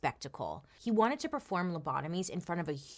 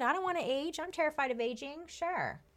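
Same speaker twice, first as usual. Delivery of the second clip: mock fearful whine; 11 semitones higher than usual. Mock fear of aging.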